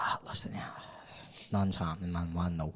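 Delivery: low, soft muttering in the car; mock-bored mutter to a mate while nothing's happening; muttered aside. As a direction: grumble it under your breath.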